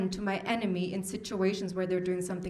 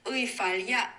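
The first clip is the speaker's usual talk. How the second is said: backwards speech. Shown as a demonstration. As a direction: play the words in reverse. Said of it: "It sounds something like this".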